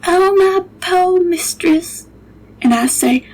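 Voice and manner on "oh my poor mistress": high, slow grieving moan; mournful. Acted. The cook lamenting her mistress, slow and mournful. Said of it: "And she says".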